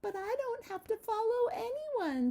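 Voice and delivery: high carefree butterfly; lightly voiced butterfly. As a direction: toss it off like a free butterfly. Butterfly voiced in a read-aloud.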